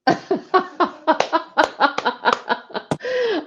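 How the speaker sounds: long, high burst of laughter; real amused laughter. Real, not acted. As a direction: laugh heartily at the performance. Coach laughs at the comic monologue.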